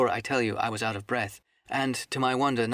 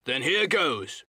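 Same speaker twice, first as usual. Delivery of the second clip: higher, mildly fierce seaman; with an oath; 4 semitones higher, slower than usual. A narrator's reading. Morgan springing up with his knife, mildly fierce cry. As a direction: throw it out as a rough challenge. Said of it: "said Morgan with an oath".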